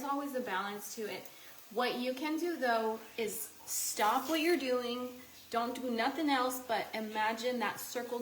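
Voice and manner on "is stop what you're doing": ordinary delivery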